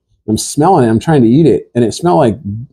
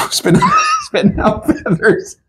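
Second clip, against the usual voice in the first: laughing while telling; slower than usual. Real, not acted. Laughing retelling.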